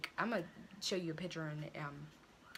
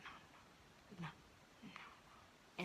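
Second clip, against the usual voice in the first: whispered no; 13 dB quieter, much slower than usual. Retold or imitated. Hesitating outside mom's door.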